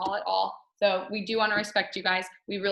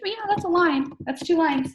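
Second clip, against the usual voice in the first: quoting the doctor higher and louder; brightly quoting the doctor, higher and louder; doctor voiced, higher; 10 semitones higher, 5 dB louder than usual. Retelling what the doctor said, higher and louder.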